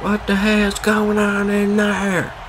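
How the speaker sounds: high, slow, drawn-out mock holler; imitated alarmed relative. Streamer imagines family hearing him go insane, high mock alarm. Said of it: "don't want my parents or my sister to be like".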